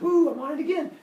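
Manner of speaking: high, loud, imitated glee; giddy. Epimetheus starting the next animal, giddy. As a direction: squeal it like an eager kid. Epimetheus thrilled, creating more.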